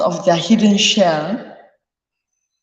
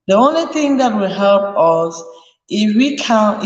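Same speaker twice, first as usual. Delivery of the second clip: ordinary talk, nothing marked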